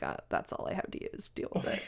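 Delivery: low, half-breathed grumble; muttered retort. Retold or imitated. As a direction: mutter it under the breath, fed up. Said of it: "And Magic's like".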